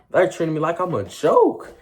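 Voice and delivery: high, loud protest; indignant. Retold or imitated. Patient complaining about the paramedics, indignant. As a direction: exclaim it, offended at being dismissed.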